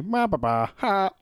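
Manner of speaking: lazy imitation of a ring announcer call; mock blasé announcer. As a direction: toss it off like a bored ring announcer. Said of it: "Doing this blasé".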